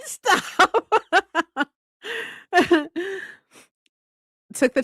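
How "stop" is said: laughing. Real, not acted. Laughing at comment.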